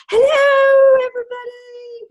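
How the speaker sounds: excited hello. Retold or imitated. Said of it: "He's like".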